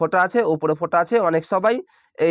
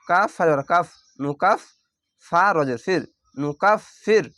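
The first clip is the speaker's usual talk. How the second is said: ordinary talk, nothing marked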